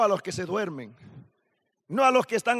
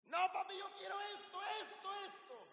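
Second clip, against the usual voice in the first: put-on high, wailing little-boy plea, pitched up; child's tantrum; 10 semitones higher, 18 dB quieter than usual. Preacher voicing his son's tantrum on the floor, high wailing whine. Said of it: "empieza a llorar a gritos".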